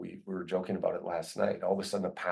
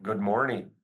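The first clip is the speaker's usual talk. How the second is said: slightly raised, called-out hello; mildly yelled greeting; 4 dB louder than usual. Retold or imitated. Man greeting his boss in the service department, mild imitated yell. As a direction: call it out like a passing greeting. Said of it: "and he yells out".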